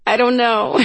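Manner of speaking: high, laughing through the words; helpless answer breaking into a laugh; cracking up. A narrator's reading. Freya answers her sister about her lost voice, cracking up. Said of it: "cracking up".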